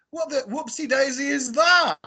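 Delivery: high-pitched mock-alarmed exclamation; startled disbelief. Retelling his reaction to the eerie voice, startled. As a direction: blurt it out, comically aghast.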